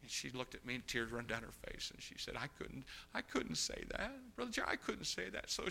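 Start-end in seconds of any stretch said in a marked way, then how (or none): 2.3-5.5 tearful plea. Retold or imitated. Girl weeping retelling.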